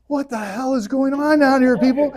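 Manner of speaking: hammed-up furious yell; angry Moses. Moses comes down to the people, mock angry yell. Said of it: "he's like".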